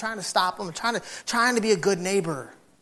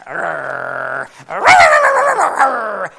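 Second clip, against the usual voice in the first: higher, louder snarling dog imitation; imitated dog growl; 3 semitones higher, 11 dB louder, much slower than usual. Imitating the neighbor's dog snarling at the fence. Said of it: "sit there and go".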